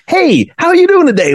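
overly friendly stranger; kooky cheery neighbour. Retold or imitated. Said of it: "kooky people in your area that are still like".

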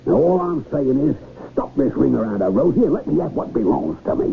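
angry. Acted. Captain loses his temper.

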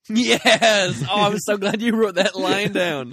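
high, loud delighted shout; excited. Real, not acted. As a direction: burst out in delight. Delighted outburst at the quote.

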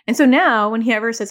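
ordinary talk, nothing marked

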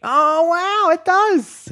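very high squeal of surprise; amazed. Real, not acted. Host hears the phone answer, amazed. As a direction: marvel in a high, delighted voice.

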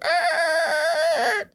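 high gasping screech; a shrill squealing tire noise; imitated tire screech. Shown as a demonstration. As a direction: tire screech sound effect. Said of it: "Why can't I make tire screeching sounds?".

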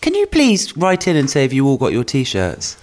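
usual speaking voice

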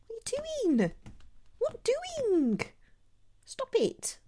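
high-pitched voice for a cat; talking to a pet. Real, not acted. Scolding cat climbing chair.

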